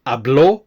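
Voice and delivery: habló rising onto its last syllable; final-syllable stress. Shown as a demonstration. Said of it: "again with the stress on the O".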